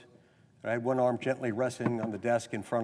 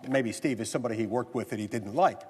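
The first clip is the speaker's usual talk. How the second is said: ordinary talk, nothing marked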